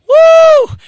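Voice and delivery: high, loud joyful holler; preacher caught up in a shouting spell; ecstatic whoop. Real, not acted. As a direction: let out a sudden woo of praise. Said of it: "I'm having a shouting spell".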